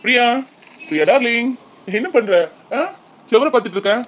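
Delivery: hammed-up sweet darling call; sweet-talking. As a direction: coax her loudly out of her sulk. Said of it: "நீங்க நடிக்கிறீங்க".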